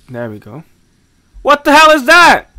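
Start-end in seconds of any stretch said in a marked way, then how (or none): 1.4-2.5 high, loud startled cry; startled shout. Real, not acted. Player spotting something creepy, startled shout. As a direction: jump at what you just saw.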